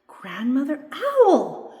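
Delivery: high, loud, drawn-out cry of delight; excited little doll spotting a wonder. A narrator's reading. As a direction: call it out slowly with rising excitement. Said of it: "spirit doll excitedly".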